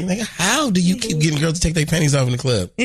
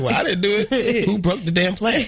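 higher mock mama laughing through it; mama laughing as she repeats "didn't"; 7 semitones higher than usual. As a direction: laugh while playing the fed-up mother. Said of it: "She's like".

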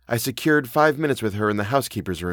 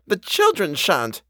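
put-on "The children shan't" high, slow and weighted; emphatic; 9 semitones higher, slower than usual. A narrator's reading. Housekeeper answers the governess, "The children shan't" slow and emphatic. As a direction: lean slowly on each word of "The children shan't", pitched up. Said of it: "she emphatically returned".

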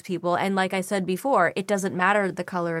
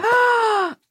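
high delighted gasp; sharp gasp. Real, not acted. Actress hears an exciting question, high surprised gasp. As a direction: gasp in real surprise and glee.

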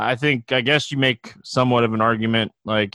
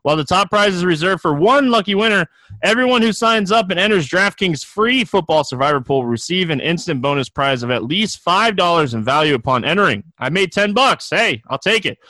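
upbeat ad read, pitched up; booming prize announcer; 4 semitones higher than usual. Acted. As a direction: sell it like a radio spot. Reads a sponsor ad.

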